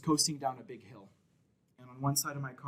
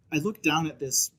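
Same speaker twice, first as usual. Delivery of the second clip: ordinary delivery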